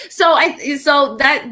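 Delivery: high real laugh spilling into words. Real, not acted. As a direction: laugh then talk through it. Laughing at her own story.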